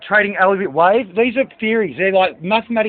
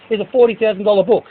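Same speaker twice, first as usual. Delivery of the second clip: normal talking voice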